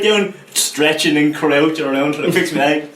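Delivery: ordinary talk, nothing marked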